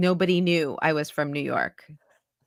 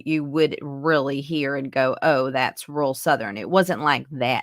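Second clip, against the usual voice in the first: thick Southern accent; 4 semitones lower than usual. Shown as a demonstration. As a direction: demo a broad Southern accent. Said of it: "it wasn't like a Southern accent".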